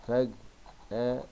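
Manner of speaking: "فجأة" slowly split into its syllables; very slow, separated "فجأة". Shown as a demonstration. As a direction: dictate "فجأة" piece by piece as you write. Dictates word while writing.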